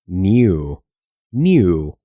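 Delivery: knew said as nu, with no K sound; silent K. Shown as a demonstration. Teacher shows learners the silent K. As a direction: drop the K in knew. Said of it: "la K se vuelve muda".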